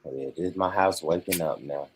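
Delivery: ordinary talk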